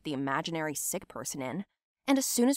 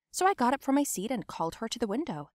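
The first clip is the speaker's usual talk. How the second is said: usual speaking voice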